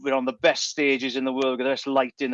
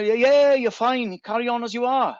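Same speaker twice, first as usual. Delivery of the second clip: breezy doctor; 9 semitones higher than usual. Retold or imitated. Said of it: "when he tells me".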